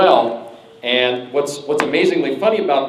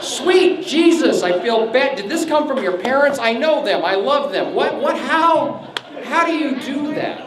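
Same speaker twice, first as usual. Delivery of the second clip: much higher, slow exasperated wonder; can't believe it, drawn out high; mock disbelief; 9 semitones higher than usual.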